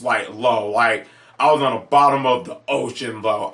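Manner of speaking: usual speaking voice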